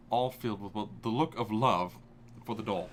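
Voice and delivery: usual speaking voice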